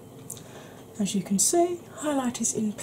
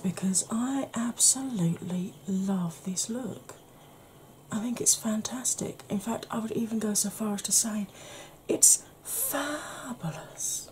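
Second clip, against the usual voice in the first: ASMR whisper; 3 semitones higher, 5 dB quieter than usual. Acted. Soft whispered recommendation.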